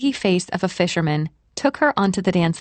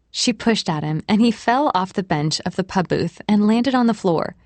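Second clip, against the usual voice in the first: usual speaking voice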